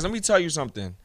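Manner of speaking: normal talking voice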